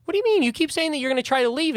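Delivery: high, eager snake creature pestering. Acted. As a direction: pitch it up as a pushy little snake.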